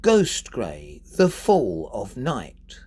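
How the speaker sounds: slow scansion with "ghost", "fall", "night" emphasised; stress on GHOST, FALL, NIGHT. Shown as a demonstration. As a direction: punch GHOST, FALL and NIGHT, lighten the rest. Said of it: "I would say ghost, fall and night".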